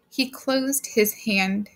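ordinary delivery, nothing marked

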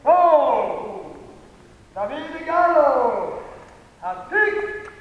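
loud, high excited winner call; excited announcer. Real, not acted. Announcing final score and winner.

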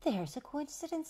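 high-pitched lady's voice. A narrator's reading. Lady answering the baby, high voice. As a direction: remark it high, without extra volume. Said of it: "said the lady".

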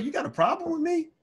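retold mad challenge. Student confronting a rude football player, riled and high. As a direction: toss the question up high, riled. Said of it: "eventually I'm kinda mad".

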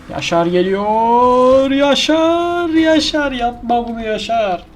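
mock commentator. Gamer calls his own player's jump like a football commentator, mock hype. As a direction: hype the name like a match announcer, slow and soaring.

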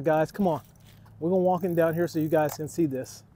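ordinary talk, nothing marked

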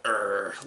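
low imitated growl; mock growl. Reviewer imitating a brutish player from the movie, mock growl. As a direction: growl like a cartoon brute. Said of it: "then he growls like".

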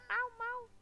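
high-pitched imitated meows; high double kitten meow; playful cat meow. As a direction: mew like a little cat.

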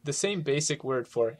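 ordinary delivery

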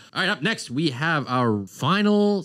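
drawn-out show host intro; announcer voice. Acted. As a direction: announce the segment slowly. Segment announcement.

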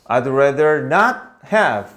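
not stressed, very slow. Shown as a demonstration. Teacher showing where not goes. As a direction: lean on not, read slowly. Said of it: "not을 여기다 쓰면 돼".

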